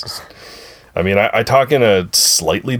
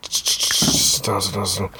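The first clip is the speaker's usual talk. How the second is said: softer, near-whispered sit down; calm them down under your breath; hushed command; 3 semitones lower, 6 dB quieter than usual. Real, not acted. Quieting others.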